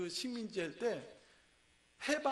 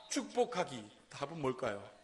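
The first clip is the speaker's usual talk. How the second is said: ordinary delivery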